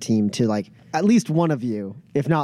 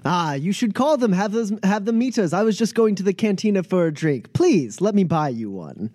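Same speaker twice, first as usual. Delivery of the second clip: higher, eager host voice; effusive Pep; 7 semitones higher than usual. Acted. Pep inviting a friend for a drink, effusive. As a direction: press a friendly invitation on them.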